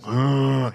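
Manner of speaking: loud zombie groan. Acted. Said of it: "hard to talk like a zombie".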